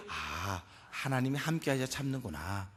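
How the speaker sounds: low, drawn "ah" into a hushed realization; quiet, low and accepting; soft realization. Shown as a demonstration. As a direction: model it softly, as if it just dawned on you. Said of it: "그냥 하시면 안 돼".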